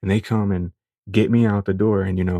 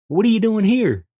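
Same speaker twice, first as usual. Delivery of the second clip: much higher startled question; high incredulous demand; shocked; 11 semitones higher than usual. Retold or imitated. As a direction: blurt it out in alarm.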